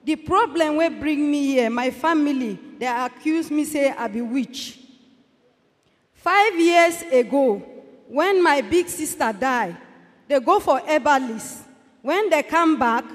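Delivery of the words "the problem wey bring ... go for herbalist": ordinary talk, nothing marked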